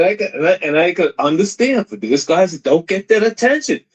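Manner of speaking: ordinary delivery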